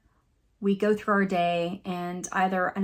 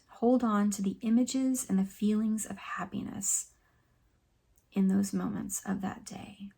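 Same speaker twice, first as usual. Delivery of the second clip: soft, calm meditation guide with long pauses; hushed, soothing relaxation voice; guided meditation; 6 dB quieter than usual. Acted. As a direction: gently lead them through breathing and reflection, leaving space.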